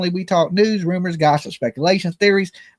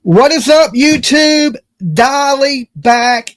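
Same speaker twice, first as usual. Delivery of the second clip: big hyped show opener; loud greeting; 6 semitones higher, 7 dB louder, slower than usual. Acted. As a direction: shout the hello to the viewers.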